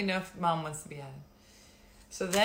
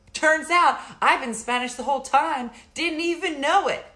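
mock teen excitement; 8 semitones higher, 10 dB louder than usual. Retelling her teen self's surprise.

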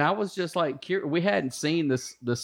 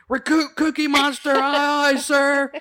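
much higher, louder sound-off; recruits sound off; 11 semitones higher, 6 dB louder, slower than usual. Retold or imitated. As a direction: chant it back like a platoon. Recruits chanting back.

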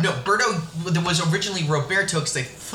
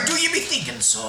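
pirate voice; 10 semitones higher than usual. Pretend. Pirate talk bit.

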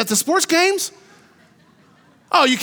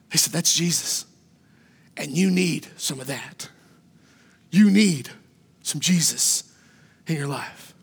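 hushed reverence; 10 semitones lower, 6 dB quieter than usual. Acted. Preacher softly retelling the city.

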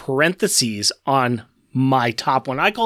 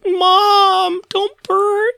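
put-on very high, loud kid voice; childish plea; 4 dB louder, much slower than usual. Victor begging his mother Ultron, squeaky and high. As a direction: squeal it like a little boy begging. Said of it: "So it's like".

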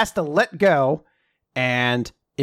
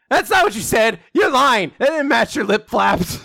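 high, loud, indignant accusation; accusing shout; 7 semitones higher, 3 dB louder than usual. Retold or imitated. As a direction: catch him in the lie and yell it. Fuko calls out his lie.

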